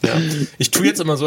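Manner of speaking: ordinary delivery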